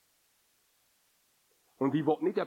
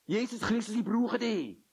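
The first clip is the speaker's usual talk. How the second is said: higher, urgent plea; pleading cry; 8 semitones higher than usual. Acted. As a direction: cry out for help as the converted heart. Gespielter Ruf zu Jesus. (höherer, dringender Ruf: ich brauche dich)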